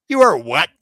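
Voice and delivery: loud, high "what?" of pure shock; stunned disbelief. Retold or imitated. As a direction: fire it back as if you misheard. Shocked by her flying claim.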